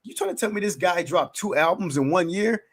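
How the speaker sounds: high, excited disbelief; amazed listener. Pretend. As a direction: gasp it out like a stunned fan. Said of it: "And you be like".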